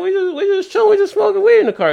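very high, fast pleading. Retold or imitated. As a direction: whine it like a stoned kid. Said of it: "Snoop's like".

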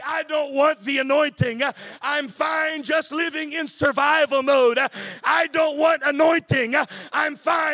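loud, driving sermon climax; preaching at full force. Acted. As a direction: hammer each line home to the congregation.

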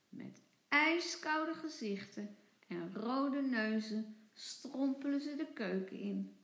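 ordinary delivery

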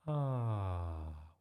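long, falling cooing "aah"; audience "aah". A narrator's reading. Audience charmed by the dog onstage, cooing aah. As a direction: coo like a charmed crowd. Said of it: "went the audience".

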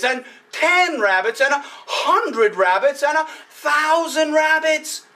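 usual speaking voice

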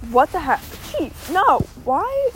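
high startled whine; surprised. Real, not acted. Startled by something on walk.

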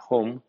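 home said the English way; English word home. Shown as a demonstration. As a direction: model how home sounds. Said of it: "que suena". (home en inglés)

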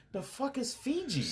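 high, slow baffled voice; confused dude. Retold or imitated. Guy in a movie asking what Fiji is, high and baffled. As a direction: act out a guy who has never heard of Fiji water.

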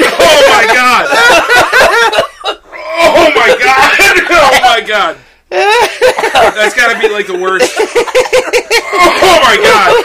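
very high, loud cries breaking into laughter; overwhelmed laughing shout. Real, not acted. Friend hit by smelling salts, laughing shout. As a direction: wail it out, overcome and cracking up.

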